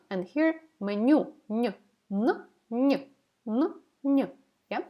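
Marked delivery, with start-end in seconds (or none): 1.5-4.3 "n" switching between ny and plain n; soft-hard alternation. Shown as a demonstration. Teacher comparing both consonants for learners, soft-hard alternation. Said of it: "Let's compare it to n".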